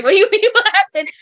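high, loud, fast and laughing; laughing disbelief. Retold or imitated. Aunt answering a curious five-year-old, high loud laughing disbelief. Kid asks why she's brown.

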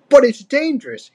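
high, worried objection; protesting. A narrator's reading. Diddy warning Dixie off the quest, protesting. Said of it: "he protested".

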